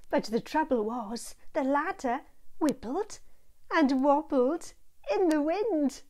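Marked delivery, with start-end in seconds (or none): none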